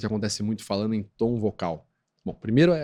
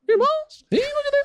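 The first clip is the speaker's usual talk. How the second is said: very high squeaky preacher impression; pastor Valdomiro; 4 dB louder than usual. Pastor addressing his brethren, high-pitched preacher mimicry. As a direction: mimic a preacher in piercing falsetto. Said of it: "o pastor Valdomiro".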